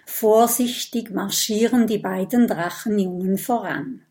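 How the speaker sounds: ordinary delivery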